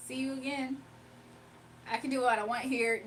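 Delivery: usual speaking voice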